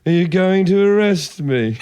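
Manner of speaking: slow, lightly put-on posh English accent; mock-polite posh English voice to a cop; exaggerated posh English accent, slow. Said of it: "in a posh English voice".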